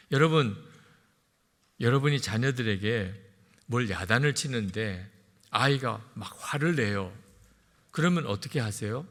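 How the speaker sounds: ordinary delivery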